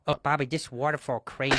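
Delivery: put-on low, soft broken-English accent; mock accent. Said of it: "doing the same fucking accent".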